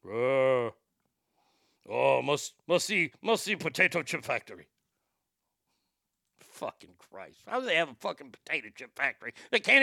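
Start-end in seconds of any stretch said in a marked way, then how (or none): 0.0-4.7 a bit lower mock Korean accent; hammed-up Korean accent with a guttural edge; mock Kim Jong-un Korean accent. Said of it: "that guttural tone".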